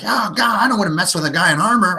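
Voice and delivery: high, fast panicky goblin squeak; scared goblin. Acted. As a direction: gabble it out in a frightened rush. Scared goblin flees the warrior.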